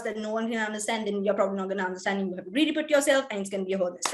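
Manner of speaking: rushed, run-together gabble; very fast talking. Shown as a demonstration. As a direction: rattle it off too fast to follow. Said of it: "if you talk really, really fast".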